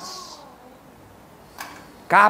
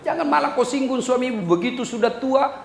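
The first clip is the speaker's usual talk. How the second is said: ordinary talk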